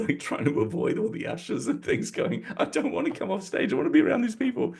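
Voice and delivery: squeaky panic. Retold or imitated. Mock panic dodging ushers on stage.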